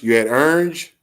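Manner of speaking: orange squeezed into about one syllable, teasing; mocking the accent. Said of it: "making fun of people accent".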